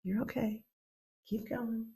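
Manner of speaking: the ocean murmuring comfort softly; soothing ocean. Acted. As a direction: hush and reassure like a tender mother. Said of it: "The ocean was like".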